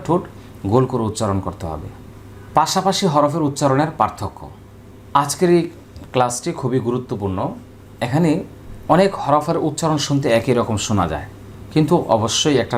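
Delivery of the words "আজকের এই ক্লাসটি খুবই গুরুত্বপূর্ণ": ordinary delivery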